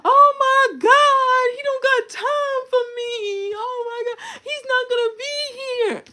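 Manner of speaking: high, loud, wailing fake sob; mock crying. Mock sobbing about a friend skipping her birthday. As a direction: ham up a whiny crybaby over a no-show. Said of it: "I'm not out here crying".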